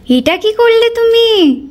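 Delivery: mock scolding. Prasad taken before bowing.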